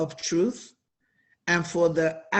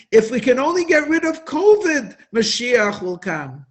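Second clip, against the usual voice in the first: mock eager quote; 5 semitones higher, 6 dB louder than usual. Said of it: "If today we would say".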